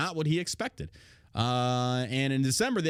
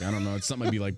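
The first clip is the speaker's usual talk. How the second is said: ordinary delivery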